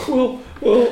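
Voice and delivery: high, loud, put-on penguin dealer voice; sheepish penguin smuggler in a squeaky put-on voice; high character voice. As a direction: play the shady penguin salesman way up high.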